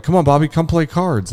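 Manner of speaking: high, coaxing call; calling him over. Retold or imitated. Grandmas call the dreamer to their card game, coaxing. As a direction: imitate grandmas inviting him to join in.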